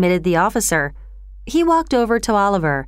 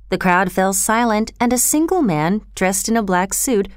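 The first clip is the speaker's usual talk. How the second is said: ordinary delivery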